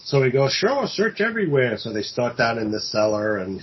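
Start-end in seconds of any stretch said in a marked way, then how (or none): 0.5-1.8 a much higher, airy sure, search everywhere; cocky, breezy invite. Retold or imitated. Killer brushing off the police with airy cockiness. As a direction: act like you've nothing to hide.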